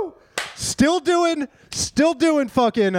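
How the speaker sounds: high, loud hyped yell; excited whoop. Real, not acted. Host whooping after the joke, excited. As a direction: hype it up, stretch it slow.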